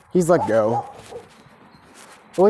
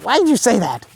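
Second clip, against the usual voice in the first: flustered; 8 semitones higher, faster than usual. Acted. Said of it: "She gets flustered up".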